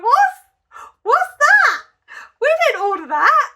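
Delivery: high, loud squeal of laughing disbelief. Retold or imitated. Diner reacting to revolting food, laughing in loud disbelief. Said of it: "started laughing. And I was like".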